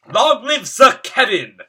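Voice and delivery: loud, high crowd cheer; cheering cry. Acted. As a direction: cheer it out like a whole town. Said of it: "the townsfolk cried".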